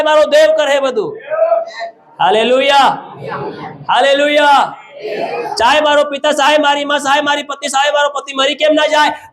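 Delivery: high, sustained proclamation; forceful preaching. Real, not acted. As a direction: declare each promise like a preacher at full pitch.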